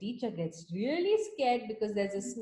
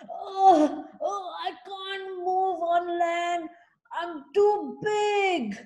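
higher, slow whimpering moan; a stranded whale groaning in distress; 6 semitones higher, 3 dB louder, slower than usual. Acted. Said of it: "moaned the whale".

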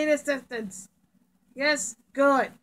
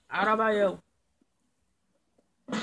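normal talking voice

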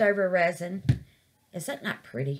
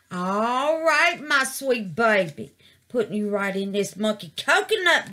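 normal talking voice

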